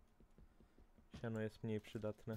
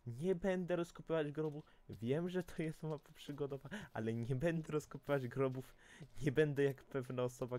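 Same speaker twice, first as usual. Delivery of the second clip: raised, emphatic protest; indignant refusal; 5 semitones higher, 4 dB louder than usual. Real, not acted. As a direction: insist you won't dig graves, voice raised. Indignant refusal to dig graves. (oburzona odmowa)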